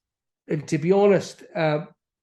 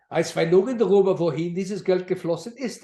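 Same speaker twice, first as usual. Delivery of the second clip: fluent German in a Swiss accent, slightly raised; 5 semitones higher than usual. Shown as a demonstration. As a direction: recite it as a Swiss German speaker. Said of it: "a Swiss German accent".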